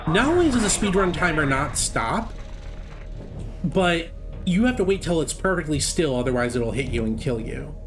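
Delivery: normal talking voice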